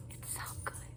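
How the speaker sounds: breathy unvoiced whisper of awe. Real, not acted. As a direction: whisper it like a secret delight. Gushing in a whisper.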